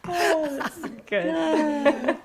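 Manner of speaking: high, slow, laughing. Real, not acted. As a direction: laugh through the words. Laughing at the joke.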